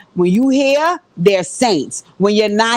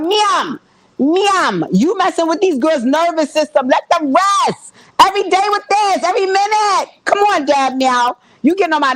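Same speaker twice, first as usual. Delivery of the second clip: exasperated scolding; 7 semitones higher than usual. Pretend.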